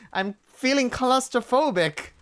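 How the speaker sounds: distressed. Acted. Voicing distress.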